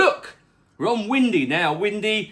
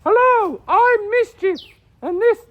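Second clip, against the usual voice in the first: much higher, louder cartoon voice; squeaky character voice; 5 dB louder than usual. Pet character introducing the weather, high and squeaky. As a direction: pipe up like a cheeky little pet.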